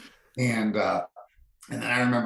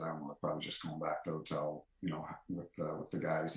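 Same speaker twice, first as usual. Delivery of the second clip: hushed reply; 2 semitones lower, 9 dB quieter, faster than usual. Retold or imitated. Ref telling Avery his plans, softer and hurried. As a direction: mumble it off quickly and quietly.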